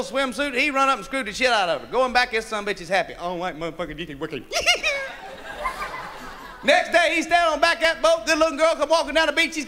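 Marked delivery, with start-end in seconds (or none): 3.1-4.4 quiet, low, grudging approval; low satisfied mutter. Acted. 4.5-6.6 high-pitched cackle; laughing. Real, not acted. Comic laugh.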